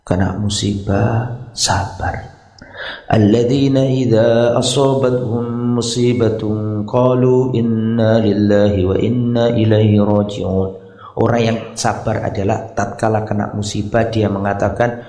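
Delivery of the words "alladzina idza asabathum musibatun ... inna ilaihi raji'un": Quran recitation. Acted. Preacher reciting the verse on patience to the congregation, chanted. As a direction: intone the verse like scripture recitation.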